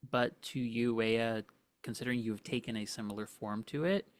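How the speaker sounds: ordinary talk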